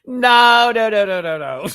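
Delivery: loud, high string of no; real loud no-no-no; emphatic refusal. Real, not acted. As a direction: shut it down loudly.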